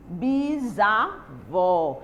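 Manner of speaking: "bisavó" very slow, broken into pieces with gaps; syllable by syllable. Shown as a demonstration. Teacher shows pupils the prefix in bisavó, syllable by syllable.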